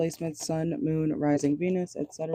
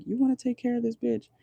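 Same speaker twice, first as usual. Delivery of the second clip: higher-pitched imitation of a doubter; mock incredulous question; 8 semitones higher than usual. Tarot reader relaying what she just heard, mock incredulous. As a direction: voice someone questioning their friend's choice. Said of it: "I literally just heard, like".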